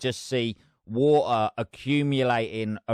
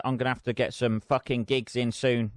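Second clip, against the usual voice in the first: ordinary talk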